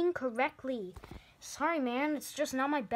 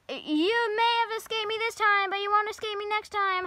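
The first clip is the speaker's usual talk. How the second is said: loud, high villain threat; mustache twirling villain; 8 semitones higher, 6 dB louder than usual. Acted. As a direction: scream the villain's parting line. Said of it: "let me scream the thing".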